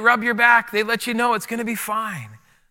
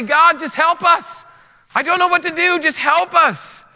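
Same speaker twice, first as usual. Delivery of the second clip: high desperate plea to God; pleading cry; 6 semitones higher, 3 dB louder than usual. Pretend. Believers crying out to God in fear, pleading. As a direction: beg for help, voice lifted and urgent. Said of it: "We just wanna say".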